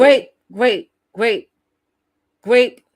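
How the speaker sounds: insistent loud wait. Real, not acted. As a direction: halt the clip, loud and insistent. Interrupting the playback loudly.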